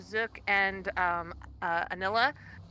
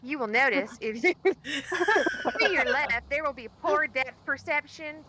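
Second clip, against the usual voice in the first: flight attendant; 5 semitones higher than usual. Acted. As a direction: announce it like airline cabin crew. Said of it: "I sound like a flight attendant as I do it".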